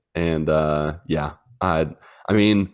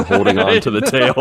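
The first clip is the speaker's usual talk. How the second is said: ordinary talk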